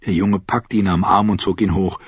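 ordinary delivery

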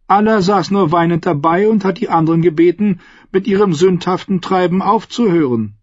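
high, commanding noblewoman; lightly voiced scheming queen. A narrator's reading.

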